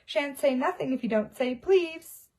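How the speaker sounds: teasing lilt; annoying sing-song. Acted. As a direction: needle him in a sing-song. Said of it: "in his annoying sing-song voice".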